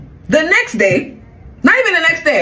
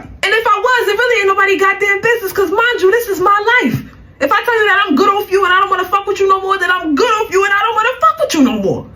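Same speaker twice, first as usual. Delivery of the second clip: raised, pitched-up heated rant with a laugh; telling the ex off, voice pitched up and heated; 8 semitones higher than usual. Real, not acted. To the ex who came banging at the door, a heated raised rant.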